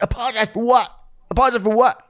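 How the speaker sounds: high, loud, incredulous protest; mock indignation. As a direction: imitate an outraged man refusing to apologize. Chris indignantly refuses, in retelling.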